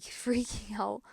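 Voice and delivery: usual speaking voice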